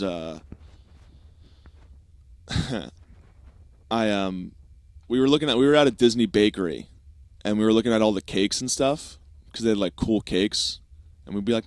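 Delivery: ordinary talk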